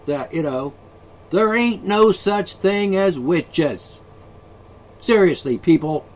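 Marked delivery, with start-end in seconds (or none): 1.3-6.1 slow emphatic rant. Real, not acted. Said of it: "Yeah, I'm ranting".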